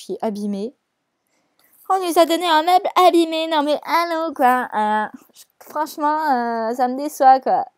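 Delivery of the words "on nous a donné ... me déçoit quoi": high, loud hammed-up indignation; mock outrage. Gamer complaining about being given damaged furniture, mock outraged. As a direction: protest loudly in exaggerated disappointment.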